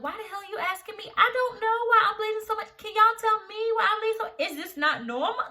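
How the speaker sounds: high, loud, fast outraged rant; indignant retort. Retold or imitated. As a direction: fire back in exasperated disbelief, pitch way up. Indignant retort to the nurse, retold.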